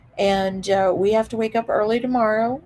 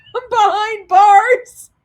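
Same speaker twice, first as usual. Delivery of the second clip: loud, high squeal through laughter; cracking up; 11 semitones higher, 10 dB louder, slower than usual. Real, not acted. Vlogger jokes about filming from jail, laughing squeal. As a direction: burst out laughing on the punchline.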